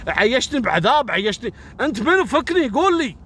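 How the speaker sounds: grab him and demand the truth, rising to a breaking point; desperate outburst. Acted. The tormented doctor grabs Mohammed in the cafe, desperate and rising.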